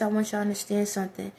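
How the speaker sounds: ordinary talk, nothing marked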